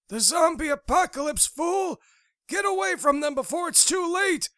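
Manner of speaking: high, loud roaring shout. Acted. Old veteran warning of the apocalypse, roaring shout. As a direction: bellow a warning at them. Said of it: "he roared".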